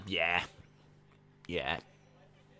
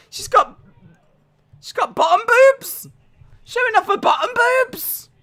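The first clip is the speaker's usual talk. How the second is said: very high, squealing disbelief, mostly loud; flustered outrage; 8 dB louder than usual. Real, not acted. Host asks if women are doing this now, shrieking and flustered. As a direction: splutter and shriek in scandalised shock. Flustered outburst over revealing outfit.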